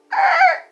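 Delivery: a sudden high yelp of disbelief; shocked high squeal. Retold or imitated. Told to park and walk, a shocked squeal.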